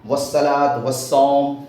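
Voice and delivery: والصلاۃ والصوم said slowly, the lam silent, sounds drawn out; slow recitation. Shown as a demonstration. Teacher slowly recites والصلاۃ والصوم for students. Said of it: "جیسے آپ نے اس طرح سے پڑھا ہوگا".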